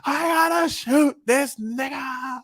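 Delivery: silly high-pitched mock yell; comic voice. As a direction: ham up a goofy character line. Mock-dubbing a character's line.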